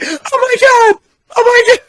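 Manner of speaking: very high, loud screaming cry of panic; mock terrified scream. Clinging to a stranger's biceps in turbulence, hammed-up terrified scream. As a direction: shriek it like the plane is dropping. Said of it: "I got screamy scared".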